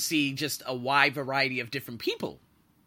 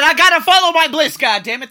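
much higher and louder, a defiant outcry; defiant shout; 12 semitones higher, 16 dB louder than usual. Retold or imitated. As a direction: yell your dream back at them. Defiant reply to worried friend.

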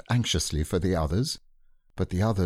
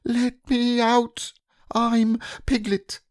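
very high, slow squeaky plea; desperate piglet; 3 dB louder, slower than usual. Acted. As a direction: beg to be let out. Piglet pleads in bath.

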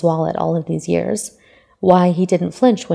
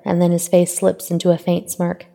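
usual speaking voice

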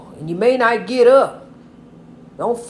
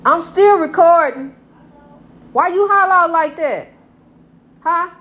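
sharp scolding; 6 semitones higher, 6 dB louder than usual. Real, not acted. Scolding someone who hollered.

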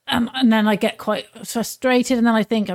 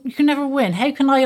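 ordinary delivery

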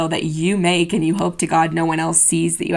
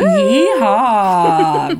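loud cowboy whoop; yeehaw; 5 dB louder than usual. Pretend. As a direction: holler it like a cowboy. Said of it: "saying anything country".

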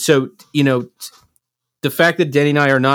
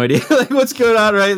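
amused, higher-pitched talk through a laugh; 10 semitones higher, 3 dB louder than usual. Real, not acted. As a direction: let a laugh lift the words. Laughs about the unaware kids.

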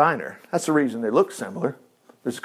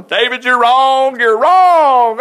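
mock rebuke; 7 semitones higher, 8 dB louder, much slower than usual. God answering David, preacher acting.

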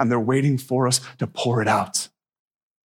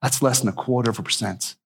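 ordinary talk

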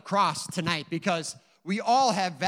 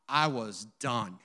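ordinary talk, nothing marked

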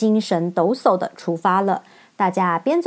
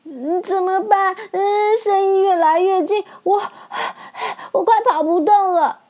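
much higher, breathless panic; out of breath; 10 semitones higher than usual. Acted. Dorothy runs from the beast, out of breath and higher. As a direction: gasp between words as you run. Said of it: "陶乐斯跑得喘不过气来".

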